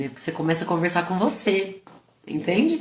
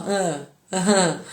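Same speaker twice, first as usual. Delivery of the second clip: nodding along; slower than usual. Retold or imitated. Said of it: "eu fico do outro lado aqui, assim, ó".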